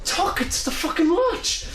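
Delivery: put-on very high, incredulous Portuguese-accented squawk; Louis. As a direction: imitate a baffled Portuguese coworker, voice shooting up. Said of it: "He's baffled".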